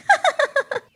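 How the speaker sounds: high gleeful crow cackle; sly cackle. Acted. Crow gloating over fooled kids, high cackle.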